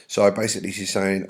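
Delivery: ordinary talk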